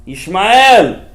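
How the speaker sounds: Yishmael called out loud and high; exclaimed name. Acted. Preacher to his audience, a loud exclaimed Yishmael. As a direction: cry the name out to the crowd.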